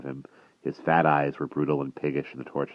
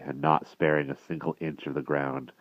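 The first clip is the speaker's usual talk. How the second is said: usual speaking voice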